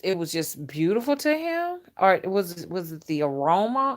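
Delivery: normal talking voice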